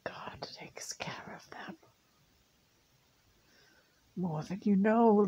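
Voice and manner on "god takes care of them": slow, soft comforting whisper. Real, not acted. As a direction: breathe it out gently.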